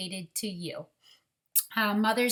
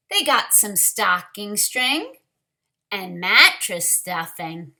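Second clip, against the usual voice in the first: lively, expressive storybook reading, louder than her chat; reading to children; 7 dB louder than usual. Acted. Teacher reading a picture book to children, lively storyteller voice. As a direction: bring the picture book alive for the class.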